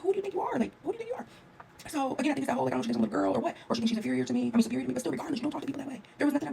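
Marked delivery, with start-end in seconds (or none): 0.0-1.2 high-pitched imitated challenge; mock serious scolding. Traveler imitating the lady's repeated challenge, mock serious. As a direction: mimic an affronted put-down, very high. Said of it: "she was so serious".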